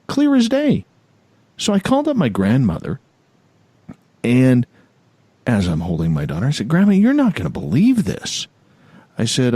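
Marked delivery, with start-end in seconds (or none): 0.1-0.9 "Clear" pitched very high, dropping through "as day"; emphatic insistence. Real, not acted. Host insisting the smell was unmistakable, emphatic. 6.7-8.5 lightly acted excited news, pitched high; retold excitement. Grandson phoning grandma with unbelievable news, mildly excited.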